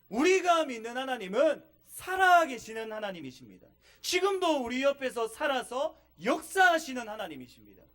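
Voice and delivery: rising preacher's conviction; fervent preaching. Real, not acted. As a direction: proclaim it to the whole church. Preaching at full force.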